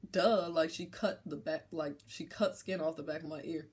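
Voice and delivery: ordinary talk, nothing marked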